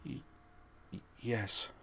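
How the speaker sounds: soft, stuttering terrified yes; terrified Wayne answering Laura, stammering softly; frightened stammer. Acted. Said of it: "Wayne whispered".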